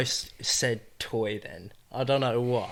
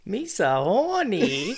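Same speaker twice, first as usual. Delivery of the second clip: Jar Jar voice; 6 semitones higher, slower than usual. Acted. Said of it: "what does fucking Jar Jar Binks say?".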